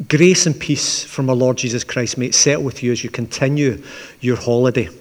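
ordinary talk

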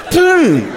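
high, loud "boom"; booming impact noise. Retold or imitated. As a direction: punch out a high crash sound. Imitated noise of dog hitting ceiling.

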